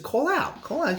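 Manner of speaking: high mock girlfriend voice, pushing; mock girlfriend telling him call out; girlfriend urging.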